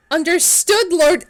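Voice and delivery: high, loud, lordly reply; grand declaration. Acted. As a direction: answer like a devoted servant. Reads game reply in grand voice.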